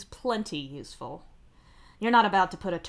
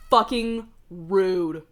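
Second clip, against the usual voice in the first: slow, loud, bitter curse; seething; 9 dB louder, much slower than usual. Real, not acted. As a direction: drag out the insult through your teeth. Ranting about the interruption.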